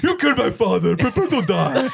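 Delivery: hammed-up goofy guy voice; mock guy voice on the edge of laughter; put-on character voice. Shown as a demonstration. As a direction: keep the silly forced voice going. Continues silly character voice workshop.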